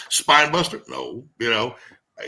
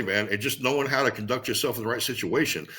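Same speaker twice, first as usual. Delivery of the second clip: ordinary delivery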